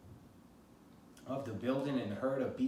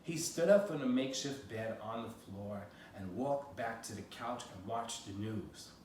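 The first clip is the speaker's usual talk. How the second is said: plain reading voice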